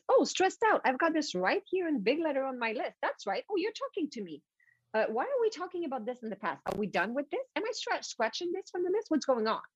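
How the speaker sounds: high, puzzled assistant checking the list; surprised little clerk; personal assistant voice. Retold or imitated.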